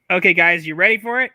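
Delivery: loud excited call to the group; rally the room before the clip; hyped call. Real, not acted. Hyping the audience up.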